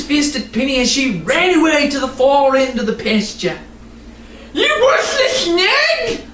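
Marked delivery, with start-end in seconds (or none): 4.5-6.2 high, slow furious yell. Acted. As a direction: yell after the runaway horse. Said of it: "Mister Nye yelled after Penny".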